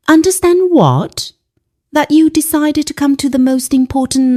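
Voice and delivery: high start, rushing on indignantly; indignant tirade. A narrator's reading. Son berating mother over his important night, indignant tirade. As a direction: throw the question high, then rattle off the accusation.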